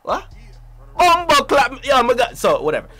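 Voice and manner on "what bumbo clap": mock Jamaican accent, jumping higher and louder; heavy Jamaican accent. Jamaican immigrant facing the citizenship quiz, hammed-up Jamaican accent. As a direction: exaggerate a baffled Jamaican outburst. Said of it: "You got a heavy-ass Jamaican accent".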